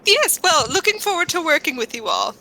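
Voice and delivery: smooth it over with airy, swooping politeness; foppish adventurer in character. Acted.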